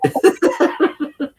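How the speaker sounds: high giggling laugh. Real, not acted. Laughs at own story.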